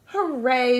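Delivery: loud happy hooray; cheerful shout. Acted. Little beaver and friends cheering together, loud. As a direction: cheer out with joy. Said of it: "shouted little beaver".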